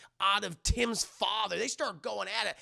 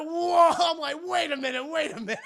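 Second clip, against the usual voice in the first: loud, high stunned protest breaking into laughter; laughing mock disbelief; 10 semitones higher, 8 dB louder than usual. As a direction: protest in shock while cracking up. Acts own stunned reaction, cracking up.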